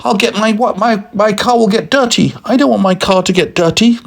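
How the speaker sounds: high, squeaky indignant whine; hammed-up outraged driver; cross driver. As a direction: protest fussily in a very high voice. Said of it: "got crosser and crosser".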